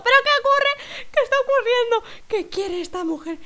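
high-pitched comic freak-out; bewildered panic. Acted. Baffled girl about a strange woman, mock panic. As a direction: squeal the questions in mock alarm, easing at the end.

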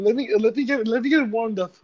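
ordinary talk, nothing marked

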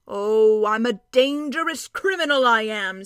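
loud, cheerful dwarf boast. A narrator's reading. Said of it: "said the dwarf cheerfully".

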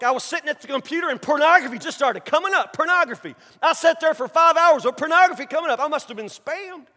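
high, loud, mock-innocent protest; excuse-maker. Man excusing his pornography, mock-innocent. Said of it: "that's how people talk".

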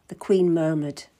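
ordinary delivery

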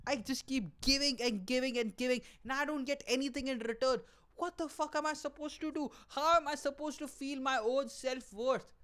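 high, strained mock hysterics; hammed-up despairing victim, crying out; losing your mind. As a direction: whine it high like someone falling apart. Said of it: "You're losing your mind".

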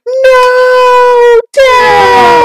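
very high, loud crying shout; mock anguished scream. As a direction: wail for a dying father, hammed-up. Son screams as father dies.

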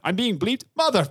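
bleeped-out mock outrage; mock outrage, cut off. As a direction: explode then get cut off mid-swear. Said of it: "he was like".

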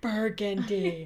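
"Burgundy" drawn out slowly in a copied odd voice, laughing; imitating Emily's Burgundy. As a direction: echo her slow, odd "Burgundy" while laughing. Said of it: "she went".

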